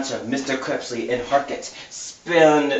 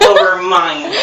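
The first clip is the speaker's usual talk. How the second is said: laughing; 8 semitones higher, 8 dB louder than usual. Real, not acted. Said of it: "Over me?".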